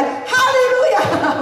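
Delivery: high, drawn-out hallelujah cry; shouted hallelujah. Retold or imitated. Preacher slamming the table in frustration, high slow shouted hallelujah. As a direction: fling it out like a fiery preacher.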